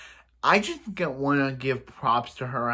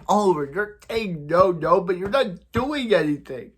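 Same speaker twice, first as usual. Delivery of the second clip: mock scolding; 5 semitones higher, 5 dB louder than usual. Mock-scolding the character on screen.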